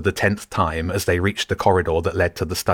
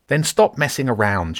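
lightly voiced caretaker barking, pitched higher; barked; 6 semitones higher than usual. A narrator's reading. Caretaker scolding Nick through the locked door, a mild sharp bark. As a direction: snap it at him like a cross caretaker. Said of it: "she barked".

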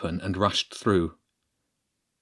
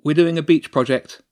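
ordinary delivery

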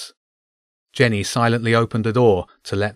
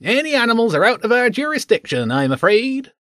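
lilting guard; 12 semitones higher than usual. A narrator's reading. Guard Mish voiced higher.

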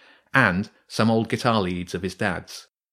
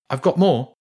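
higher, louder quick offer; boy's quick offer; 5 semitones higher, 6 dB louder than usual. Acted. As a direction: hold out the bait fast. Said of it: "James said quickly".

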